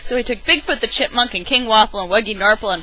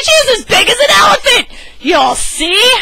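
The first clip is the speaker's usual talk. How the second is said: high, loud emphatic claim; insistent shout; 7 dB louder than usual. Acted. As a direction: insist loudly, sure of himself. Louie insists loudly, continuing shout.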